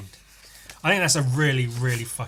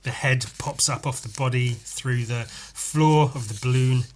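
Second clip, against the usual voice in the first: ordinary delivery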